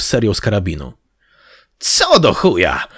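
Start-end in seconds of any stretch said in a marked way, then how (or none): 1.8-2.9 loud, drawn-out growled question; startled, angry outburst; angry snarl. A narrator's reading. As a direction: snarl it, raised and stretched. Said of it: "warknął Jabol".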